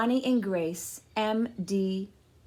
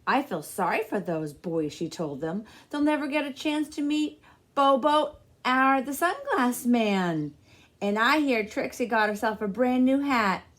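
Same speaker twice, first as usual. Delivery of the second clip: a hint of a no-nonsense grandmother; lightly voiced grandma; 5 semitones higher, 6 dB louder than usual. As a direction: set the boy straight, then brighten. Nana answering.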